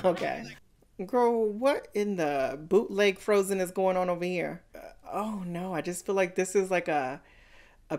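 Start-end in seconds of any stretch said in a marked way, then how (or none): none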